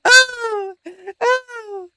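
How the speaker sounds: loud, high wailing "oh"; imitated hollering. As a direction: holler helplessly in a mock high voice. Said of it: "she's just hollering out".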